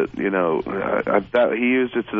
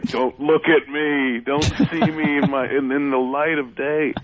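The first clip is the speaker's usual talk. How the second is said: higher, slow, pleading hide-me voice; wife's plea; 5 semitones higher, slower than usual. Retold or imitated. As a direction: beg not to be seen. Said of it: "And it's code for".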